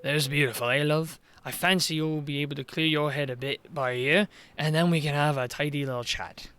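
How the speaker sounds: a lightly voiced Welsh village mayor, low and coaxing; scheming mayor charming a girl, low Welsh lilt. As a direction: sweet-talk the girl in a soft Welsh lilt.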